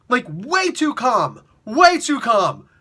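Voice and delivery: "way too calm" loud and slow, with WAY stretched out; drawn-out disbelief. Acted. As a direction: lean hard and long on WAY in "way too calm". Mock disbelief at calm reaction.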